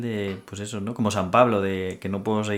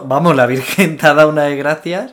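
louder, higher laughing exclamation; 5 semitones higher, 10 dB louder than usual. Real, not acted. Laughing exclamation about grace.

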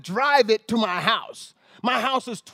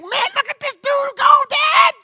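much higher, louder amazed call; amazed shout; 4 dB louder than usual. Retold or imitated. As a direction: cry out in wonder at him. Amazed calling to dad.

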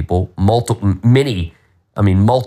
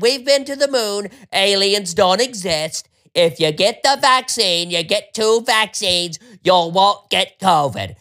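mocking Fauci; 7 semitones higher than usual. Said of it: "was them Fauci's fucking midget ass".

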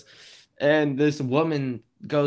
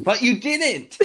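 higher and louder, calling her out; indignant exclaim; 10 semitones higher, 7 dB louder, slower than usual. Real, not acted. To friend who skipped boogie boarding, louder indignant shout. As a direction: blurt it in disbelief.

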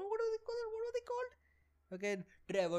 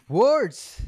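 frustrated shout; 6 semitones higher, 15 dB louder than usual. Real, not acted. Frustrated at fumbling words.

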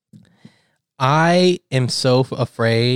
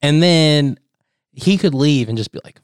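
ordinary talk, nothing marked

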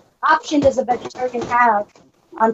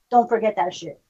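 ordinary talk

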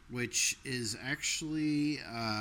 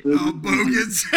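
laughing; 10 semitones higher, 13 dB louder, slower than usual. Real, not acted. Amused delighted laugh.

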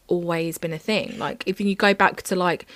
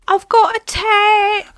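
high, loud, slow excited squeal; mock Love Island contestant; 13 semitones higher, 8 dB louder, slower than usual. Mocking Love Island contestants, high excited squeal. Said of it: "when they all go".

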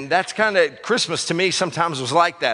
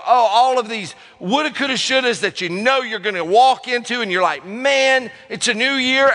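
higher and louder, all fired up; hyped new year voice; 10 semitones higher, 5 dB louder than usual. Pretend. Preacher voicing hopeful people at new year, excited. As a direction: sell the big fresh start. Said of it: "you go".

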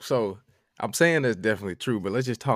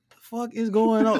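much higher, louder cry of confusion; shocked outburst; 12 semitones higher, 7 dB louder than usual. Retold or imitated. Reacting to a stranger's roommate text, shocked outburst. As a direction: blurt it out in comic shock.

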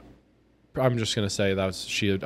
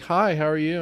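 higher-pitched switch to politeness; put-on game face; 6 semitones higher than usual. Drive-through woman greeting the customer, higher game-face voice. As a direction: imitate a sudden customer-service greeting. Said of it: "totally game face".

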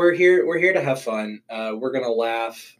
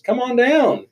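high, bright come on down; game show invitation; 13 semitones higher than usual. Pretend. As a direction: call it out like a host. Mock invitation to watch them.

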